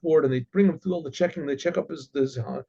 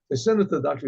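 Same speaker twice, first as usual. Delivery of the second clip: ordinary talk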